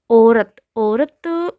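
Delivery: slow, loud औरत said with ओ; teacher mocking the wrong ओ vowel; deliberately wrong pronunciation. Shown as a demonstration. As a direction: stretch औरत out to show how it sounds wrong. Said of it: "अच्छा नहीं लग रहा ना".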